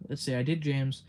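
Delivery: normal talking voice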